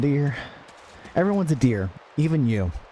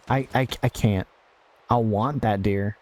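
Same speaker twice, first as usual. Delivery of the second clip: ordinary talk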